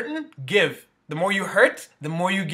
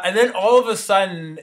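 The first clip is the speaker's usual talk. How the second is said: normal talking voice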